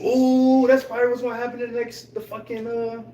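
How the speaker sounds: high, loud excited burst. Real, not acted. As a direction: hype up the prediction. Excited fan prediction.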